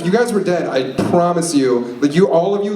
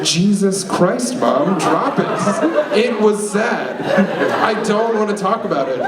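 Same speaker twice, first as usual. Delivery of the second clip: higher-pitched, fed-up plea to Mom; exasperated retort; 5 semitones higher than usual. Retold or imitated. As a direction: act out losing patience with a prying mother. Acted snapping at prying mom.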